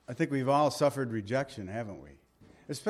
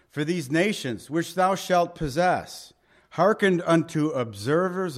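louder, solemn King James reading; scripture reading; 5 dB louder than usual. Acted. Pastor reading Deuteronomy to the church, solemn and louder. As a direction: proclaim the verses to the congregation.

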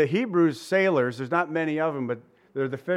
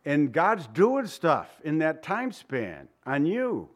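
ordinary delivery

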